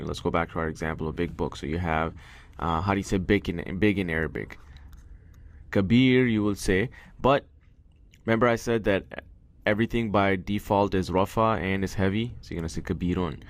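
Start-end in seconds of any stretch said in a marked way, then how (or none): none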